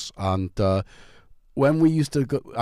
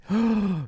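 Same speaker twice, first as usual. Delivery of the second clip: mock gasp; 10 semitones higher than usual. Real, not acted. Reading the drink's name.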